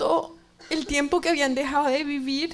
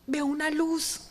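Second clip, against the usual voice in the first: ordinary talk, nothing marked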